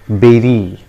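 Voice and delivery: "berry" as BEH-ree, falling; correct pronunciation. Shown as a demonstration. Teacher giving learners the correct form, "berry" as BEH-ree. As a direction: say "berry" with an eh vowel. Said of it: "It is pronounced as".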